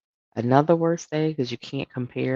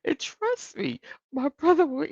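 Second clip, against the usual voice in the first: ordinary talk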